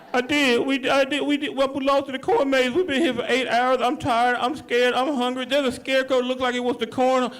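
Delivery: a lost man pleading with 911, tired and frightened; panicky caller. Acted.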